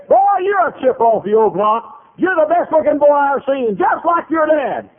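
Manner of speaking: high, loud gushing quote; loud hearty praise. Retold or imitated. Man praising a preacher's son to his face, high and booming. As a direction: boom it out, stretching the ends.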